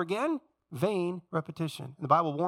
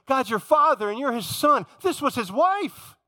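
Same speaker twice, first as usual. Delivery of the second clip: objecting listener; 8 semitones higher, 6 dB louder than usual. Retold or imitated. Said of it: "You say".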